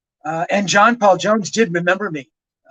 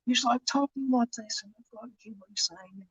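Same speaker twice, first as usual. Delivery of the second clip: a light, soft mock of a mumbled Birmingham accent; 5 semitones higher, 13 dB quieter than usual. Writer mimics the mumbled tape he is transcribing, softly voiced. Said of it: "very thick Birmingham accent, and again, he doesn't speak very loud".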